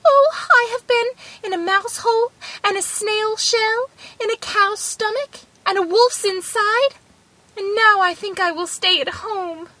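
high, piping little-man voice; little adventurer. A narrator's reading. Tom Thumb recounting adventures to his father, high-pitched. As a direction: list your travels in a tiny high voice.

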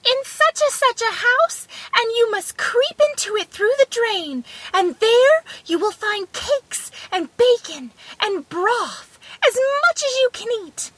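high, piping little-man voice; tiny tempter. A narrator's reading. Tom Thumb tempting the wolf with food, high and coaxing. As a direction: tempt the listener in a small high voice.

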